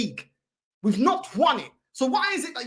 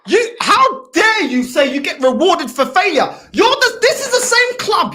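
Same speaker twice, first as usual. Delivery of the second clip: indignant shout; 3 semitones higher, 9 dB louder than usual. Real, not acted. Fan challenging a rival's talk of rewarded failure, indignant. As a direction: thunder back in real outrage.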